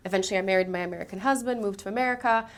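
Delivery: normal talking voice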